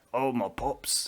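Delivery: soft, lightly put-on character voice; imitating the Pups guy. Quoting the Pups guy to a co-host, lightly put-on voice. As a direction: quietly mimic the movie character. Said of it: "I call him the Pups guy".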